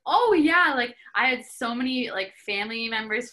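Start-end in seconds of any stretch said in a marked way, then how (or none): 0.1-0.7 high, loud teasing insistence; teasing oh yeah. Retold or imitated. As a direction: tease her like you caught her out. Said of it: "they're like".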